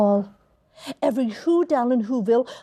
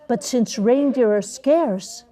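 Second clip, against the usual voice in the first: plain reading voice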